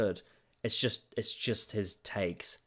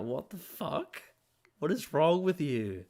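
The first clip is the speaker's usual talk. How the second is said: higher, slow disbelieving mock outrage; 8 semitones higher, 3 dB louder, slower than usual. Reacting to Davis's Doctor Who takes, higher mock outrage. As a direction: act out baffled outrage, pitched up. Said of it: "And I'm like".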